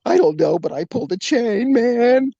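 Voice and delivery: mock whine rising in pitch; nearly tearful. Young man explaining himself to his backup, mock tearful. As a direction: plead as if almost crying. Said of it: "I was almost in tears".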